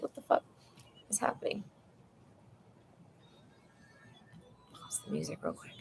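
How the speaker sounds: hushed unvoiced whisper. Real, not acted. Nervous about people in the hallway, whispering. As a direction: whisper it so nobody outside hears.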